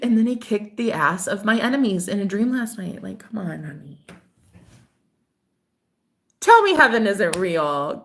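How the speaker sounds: usual speaking voice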